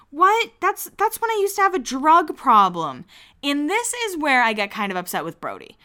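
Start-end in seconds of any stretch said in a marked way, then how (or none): 0.1-3.1 shocked. Retold or imitated. Steph shocked at being called crazy.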